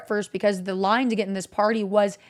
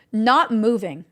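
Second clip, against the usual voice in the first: ordinary talk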